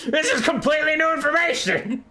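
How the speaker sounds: high screech breaking into laughter; laughing outrage. Acted. Skrulk fuming about Thanquil, high voice broken by laughter. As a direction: screech indignantly while cracking up.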